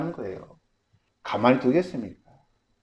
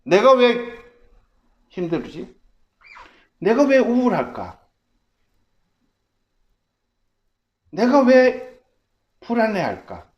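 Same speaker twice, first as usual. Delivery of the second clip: self-questioning voice; 10 semitones higher, 8 dB louder than usual. Pretend. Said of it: "그러면 질문하세요".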